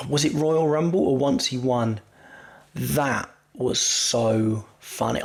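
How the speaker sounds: ordinary talk, nothing marked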